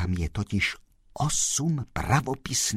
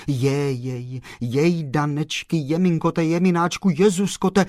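rising, louder string of dismayed exclamations; sympathetic fussing; 8 semitones higher, 6 dB louder than usual. A narrator's reading. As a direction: fuss with sympathy, building higher and louder. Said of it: "řekl soucitně pan Kolbaba". (soucitné lamentování, stále výš)

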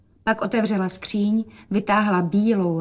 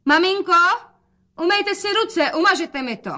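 high, raised call; 11 semitones higher, 4 dB louder than usual. A narrator's reading. Daughter tells mother to wash hands, calling out. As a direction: order mother about in a high, carrying voice.

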